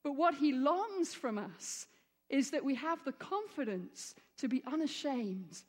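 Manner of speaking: ordinary delivery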